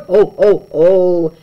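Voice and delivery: loud booming ho ho ho; big jolly Santa laugh; Santa roar. Acted. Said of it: "Santa roared".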